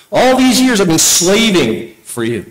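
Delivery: sulky quote, drawn out; pouty. Acted. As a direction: whine it like a resentful son. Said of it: "Did I sound pouty enough?".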